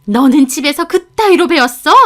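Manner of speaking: angry mother-in-law. A narrator's reading. Mother-in-law phoning her daughter-in-law at work, angry scolding. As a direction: lash out without any preamble. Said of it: "다짜고짜 화를 내시는 겁니다".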